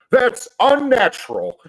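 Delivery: high, loud, slow disgusted cry; that's unnatural, squealed high and slow; Beacon disgusted. Acted. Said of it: "he just goes".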